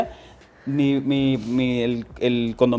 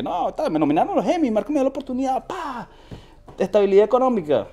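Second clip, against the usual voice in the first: mock high voice; 11 semitones higher than usual. Mocking how people imagine his success.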